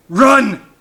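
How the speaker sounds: high, loud command to flee; urgent shout. A narrator's reading. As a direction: shout a warning. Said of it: "Paul shouted to his family".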